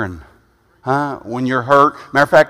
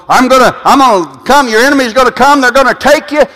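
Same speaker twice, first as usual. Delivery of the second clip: a loud, higher mock warning of God; voicing God's warning; 10 semitones higher, 8 dB louder than usual. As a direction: thunder the threat like God. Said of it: "when he goes".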